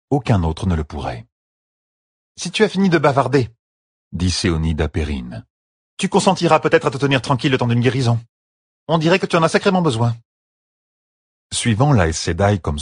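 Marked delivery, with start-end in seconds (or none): 2.4-3.5 higher-pitched voice of an impatient sister; brisk, scolding healer. Acted. Séonide orders Perrine to be healed, brisk and scolding. 6.0-10.2 high, fast, impatient character voice; brisk, scolding healer. Acted. Séonide tells Perrine to hold still for healing, brisk and scolding.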